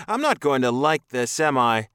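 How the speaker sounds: high, loud, anxious voice; alarmed captive. A narrator's reading. Captive man to his alien captor, alarmed.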